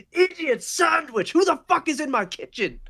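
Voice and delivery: put-on high, outraged old British chef; high-pitched furious chef shout; yelling British chef. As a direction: yell like a British chef defending the kitchen. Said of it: "some old fucking British chef is yelling".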